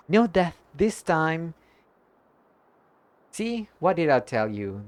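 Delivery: usual speaking voice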